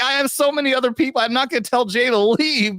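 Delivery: high, fast exasperated inner voice; mock panic over the guest list; high mock thought. Host recalling his show running long, high and fast. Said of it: "I'm like".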